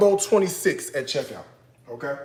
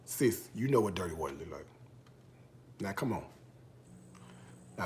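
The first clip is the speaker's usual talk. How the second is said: low, quiet scolding; restrained warning; 5 semitones lower, 6 dB quieter than usual. Real, not acted. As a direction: hold back and warn them softly, with pauses.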